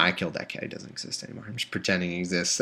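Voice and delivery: hushed unvoiced joke; whispered aside. Real, not acted. Reader joking about his cat, whispered aside.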